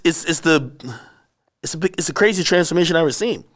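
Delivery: ordinary talk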